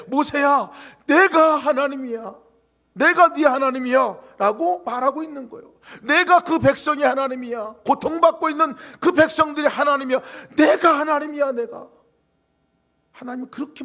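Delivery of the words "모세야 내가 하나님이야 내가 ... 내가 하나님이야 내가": higher and louder, ringing; God's booming declaration. Pretend. Preacher voicing God to Moses, booming and high. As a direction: proclaim it over the congregation. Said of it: "하나님께서 말씀하신 것은".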